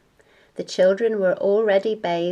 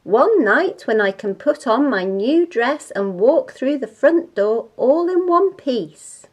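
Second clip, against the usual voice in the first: louder, swooping, pleading outburst; exasperated mother's rant; 4 semitones higher, 5 dB louder than usual. Acted. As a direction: rant with heavy punches on key words.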